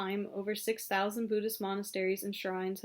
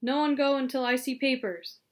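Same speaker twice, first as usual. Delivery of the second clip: louder, higher officer's order; commanding; 4 semitones higher, 7 dB louder than usual. A narrator's reading. Chinese captain halting the climbers, loud command. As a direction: order them firmly to stop, voice raised.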